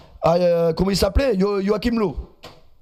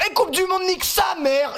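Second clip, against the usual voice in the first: high-pitched exclamation, acting out the keeper; mock shouting, pitched way up; hammed-up outburst quote; 9 semitones higher than usual. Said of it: "Il a dit".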